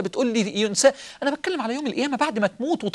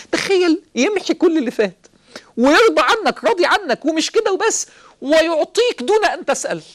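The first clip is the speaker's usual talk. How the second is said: higher, louder fervent preaching; preaching at full force; 7 semitones higher, 5 dB louder than usual. Acted. Preacher urging the listeners to imagine forgiveness, fervent and loud. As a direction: lift the crowd with rising fervor.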